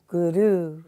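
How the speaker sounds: "Guru" with the first syllable "Gu" pushed; stress on gu. Shown as a demonstration. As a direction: lean on "Gu" then let "ru" fall. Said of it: "little emphasis on the gu".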